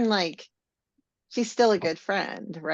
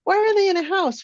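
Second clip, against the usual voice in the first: confused, much higher pitch; high surprised question; 13 semitones higher, 6 dB louder, much faster than usual. Retold or imitated. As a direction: sound baffled and astonished. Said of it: "I'm like".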